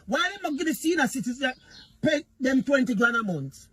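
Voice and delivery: ordinary talk, nothing marked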